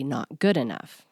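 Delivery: usual speaking voice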